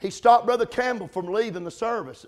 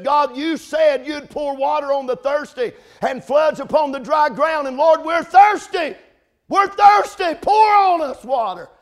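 high, loud pleading shout; preacher shouting the young men's desperate prayer, louder and higher; desperate cry to God; 7 semitones higher, 8 dB louder than usual. Retold or imitated. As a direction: cry out to God as if parched with thirst. Voicing desperate prayer of revivalists.

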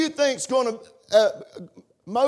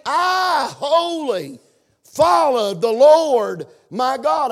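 preaching emphasis; 4 semitones higher, 5 dB louder, slower than usual. Acted.

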